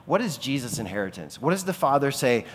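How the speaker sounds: normal talking voice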